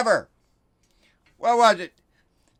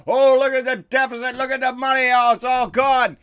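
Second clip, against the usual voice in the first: mock griping; 6 semitones higher, 6 dB louder than usual. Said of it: "they start griping about".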